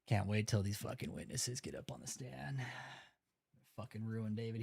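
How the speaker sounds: a low, breathy whisper of glee. Real, not acted. Streamer whispering to viewers that David is doomed. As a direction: whisper it like a secret threat. Gloating about witnesses, hushed.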